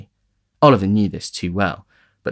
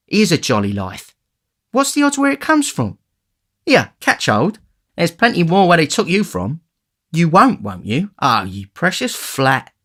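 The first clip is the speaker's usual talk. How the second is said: a hint of a boastful street boy, rising higher; lightly voiced cocky young thief; 9 semitones higher, 4 dB louder than usual. A narrator's reading. The Dodger boasting to Oliver, lightly voiced and cocky. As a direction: brag down the list of names, lightly.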